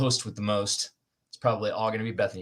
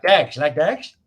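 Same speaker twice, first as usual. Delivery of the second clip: put-on movie-quote British accent, louder and higher; 4 semitones higher, 7 dB louder, slower than usual. Quoting the movie Snatch to the co-hosts, British accent. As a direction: do a punchy British accent on the question. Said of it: "It's my best British accent".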